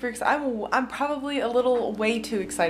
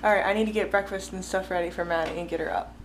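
ordinary delivery

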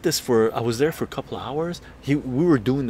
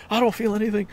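raised, high voice, denying any effect; high stubborn insistence; 10 semitones higher, much faster than usual. Retold or imitated. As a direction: squeak it out like he feels nothing. Friend again insists.

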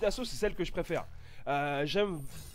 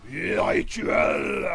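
slow breathy hushed proclamation; ominous announcement; 2 semitones lower, 5 dB louder, much slower than usual. Pretend. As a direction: announce it like a solemn rite. Announcing the ritual.

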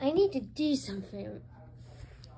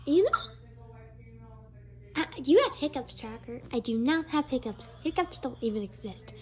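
usual speaking voice

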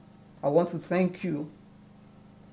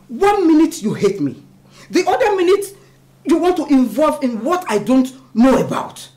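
loud, high-pitched confrontation; furious, raised voice; angry accusation; 9 semitones higher, 12 dB louder than usual. Acted. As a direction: hurl the accusation at him at full volume. Acted quarrel, mother confronts Mark.

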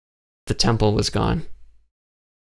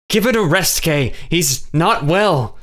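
higher, tense rebuke, the last words slow; growled; 7 semitones higher, slower than usual. A narrator's reading. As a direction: warn her off sharply. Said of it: "Jonathan growled".

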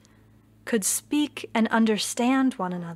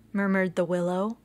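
ordinary delivery, nothing marked

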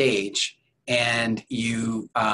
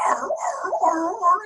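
high raspy barking arr, arr; hoarse dog bark imitation; slower than usual. Telling audience about the debarked dog, imitating its hoarse bark. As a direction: mimic a dog's broken, voiceless bark. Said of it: "it was, like, going".

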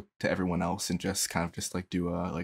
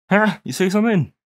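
much higher, louder question; loud deafened call; 11 semitones higher, 12 dB louder than usual. Acted. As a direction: shout it like your ears are plugged. Can't hear through earplugs.

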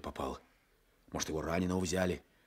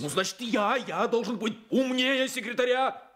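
loud and much higher, mocking himself; high, loud sarcastic cry; bitter sarcastic outburst; 8 dB louder than usual. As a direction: fling it back in angry irony.

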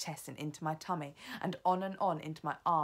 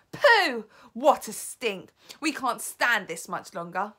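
high, loud cries of disgust; 5 semitones higher, 11 dB louder than usual. Acted. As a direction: witches gagging at the stink, loud. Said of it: "there were cries of".

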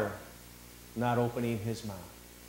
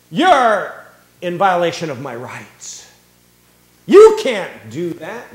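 loud, slow, outraged protest; mock indignant protest; 3 semitones higher, 14 dB louder, slower than usual. Preacher imitating someone lecturing a policeman, loud and outraged. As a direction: act the offended citizen.

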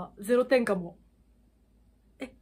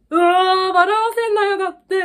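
high, loud fangirl outburst; thrilled squeal; 9 semitones higher, 8 dB louder, faster than usual. Retold or imitated. Viewer excitedly echoing a line from the video, thrilled squeal.

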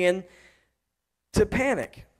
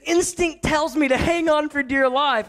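higher, girlish imitation of his daughter; mimicking his daughter; 6 semitones higher than usual. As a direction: echo her line in a higher little-girl voice. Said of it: "so she said".

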